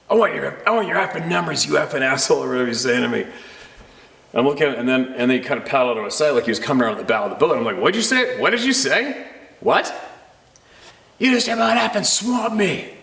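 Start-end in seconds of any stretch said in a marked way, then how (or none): 0.1-2.4 higher, hammed-up angry stranger; mock furious canoe guy demanding numbers; mock furious yell. 7.8-9.9 higher, disbelieving; mock incredulous boater. Speaker confronting the stranger. 11.2-12.9 much higher, whiny furious paddler; angry canoe guy, hammed up; mock angry stranger.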